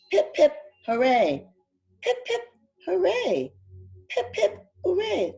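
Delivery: high cheering "Pip, pip, hooray" with P for H; toothless cheer. Acted. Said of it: "missing all of his teeth".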